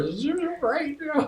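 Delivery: very high, squeaky imitation of happy sobbing; mock crying. Husband crying while telling his wife, mock high-pitched blubbering. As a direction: squeal it upward as if blubbering with joy. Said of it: "crying, and I'm telling her".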